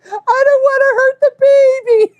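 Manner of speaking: very high, loud hammed-up protest; retold panicked wail. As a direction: wail it like your panicked self. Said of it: "I'm screaming 'cause I'm fucking scared".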